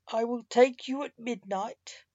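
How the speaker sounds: ordinary delivery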